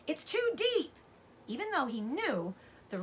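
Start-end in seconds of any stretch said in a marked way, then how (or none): 0.1-0.9 high desperate scream. A narrator's reading. Boy screaming a warning over the river, desperate. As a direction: shriek it across the rushing river. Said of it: "So he screamed again".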